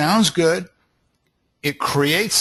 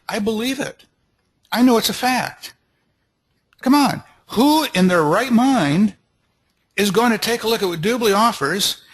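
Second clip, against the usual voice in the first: enthusiastic; 4 semitones higher than usual. Shown as a demonstration. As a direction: let your belief ring out, slowing on who in their right mind. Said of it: "you can hear the enthusiasm".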